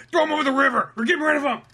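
high-pitched acted command; mock urgent order. Acting out an order against someone.

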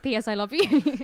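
hammed-up stage Irish accent; bad Irish accent. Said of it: "a really bad Irish accent".

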